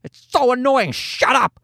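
drawn-out, fed-up scolding; acted parental irritation; mock exasperation. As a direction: tell off a baby in pretend frustration, slowly at first. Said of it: "you hate to say to the kid".

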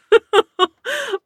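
high squealing laugh. Real, not acted. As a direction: burst of high-pitched laughter. Laughs hard after the story.

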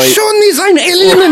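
high, slow mock German; German accent. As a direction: bark it like a movie Nazi. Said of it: "he says it in German".

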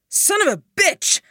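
high, loud angry curse; cursing. Acted. Duncan boxed in by gas, high loud angry curse. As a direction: spit out the curse in shock.

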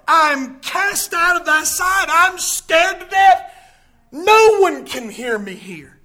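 high, loud, drawn-out cry of despair; Jonah. Acted. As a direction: preach the acted terror slowly and forcefully. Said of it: "He says in verse number four".

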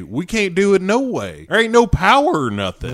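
high, drawling country voice; imitating Joe. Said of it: "Joe is like".

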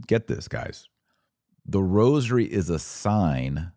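usual speaking voice

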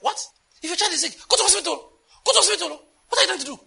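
high, loud alarmed outburst imitating the pastor; shocked alarm. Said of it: "He was shocked, like".

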